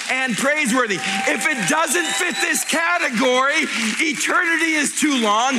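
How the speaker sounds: high, loud, driving sermon; full-force preaching. Acted. Pastor firing up the congregation, loud preaching. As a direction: preach it out to the crowd.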